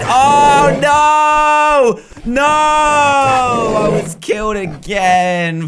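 loud high wail of defeat; mock despair as the bear dies again; dramatic shout. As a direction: howl it like a doomed hero.

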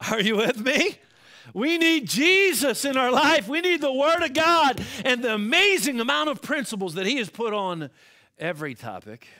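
loud, high, ringing sermon delivery; preaching. Acted. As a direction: preach it out to the whole church. Preaching to congregation.

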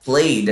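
"played" repeated as one syllable, PLAYD; soft d closing "played", no extra vowel; correct soft -ed. Shown as a demonstration. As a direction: model "played" in one beat. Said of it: "a gente fala".

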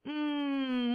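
Mm hummed very softly with closed lips; letter sound. Shown as a demonstration. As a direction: hum it quietly. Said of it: "¿cómo suena?".

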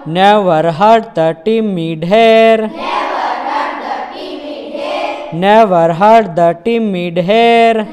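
measured, singsong recital with held line endings; chant-like verse, drawing out the last word; poem recitation. Shown as a demonstration. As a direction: recite it line by line for pupils to repeat. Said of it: "how to recite the poem".

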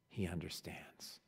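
whispered, tender. Real, not acted. Preacher comforting the congregation, whispered. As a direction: breathe it out gently. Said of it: "closing thought, whispered".

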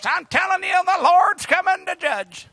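high, squeaky preacher voice; little squeaky voice. Acted. Mocked storefront preacher warning of judgment, squeaky voice. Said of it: "Got that little squeaky voice".

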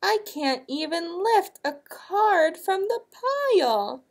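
high, whining wail without real tears; mock sobbing knight. A narrator's reading. Knight struggling in sandy armor, mock sobbing. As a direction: wail it high, storybook distress. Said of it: "sobbed the bravest knight".

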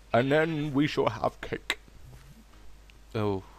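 ordinary talk, nothing marked

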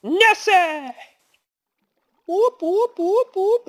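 high, loud cheering; loud, high-pitched victory calls; celebratory whooping. Real, not acted. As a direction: whoop in triumph. Celebrating creature's success.

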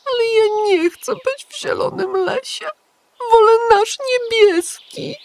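put-on a small boy sulking for home; aggrieved little hedgehog. A narrator's reading. Igiełka sulking to the pigeon, high whiny child voice. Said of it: "Na nowo rozżalił się Igiełka".

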